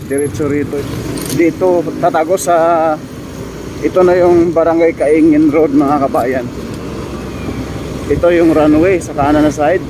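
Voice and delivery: loud, high, calling out over noise; shouting over the traffic. Real, not acted. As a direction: raise your voice over engine noise.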